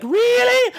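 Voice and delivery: put-on high squeaky girlish surprise; mock delight. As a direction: chirp it as a thrilled film heroine. Mimicking delighted heroine.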